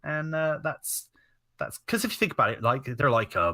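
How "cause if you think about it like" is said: ordinary talk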